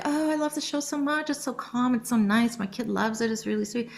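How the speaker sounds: a fan parent praising the show; mock adoring parent; gushing fan parent. Imitating fans of the show.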